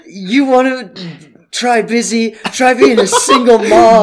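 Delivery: high, loud mock yelling; retold yell. Lady yells from her car at busy man, high mock yell. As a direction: holler it like an indignant driver, imitating her. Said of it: "She yells".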